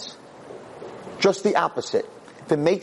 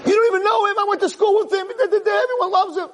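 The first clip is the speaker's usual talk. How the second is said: much higher, loud, outraged pitch; indignant protest; 3 dB louder than usual. Retold or imitated. Husband defending his schoolmate to his wife, indignant. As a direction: protest that she's being absurd.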